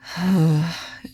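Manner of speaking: heavy weary sigh; deep sigh. A narrator's reading. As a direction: sigh from the depths of the soul. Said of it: "A sigh that seemed to come from the depths of my soul".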